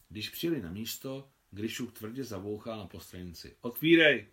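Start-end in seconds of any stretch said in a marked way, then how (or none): 3.6-4.3 loud, high call to open up; Adam shouting to be let out. Acted. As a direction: yell Otvírej through the tarp. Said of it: "křikl Adam".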